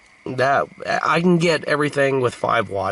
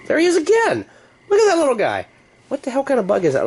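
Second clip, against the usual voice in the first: much higher, louder excited exclaim; 3 dB louder, faster than usual. Real, not acted. Bug pops up again, loud excited exclaim. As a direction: burst out as it reappears.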